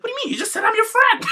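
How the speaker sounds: very high, squealed imitation of indignation; mock protest. Imitating Summer objecting to Rosa, high-pitched mock outrage.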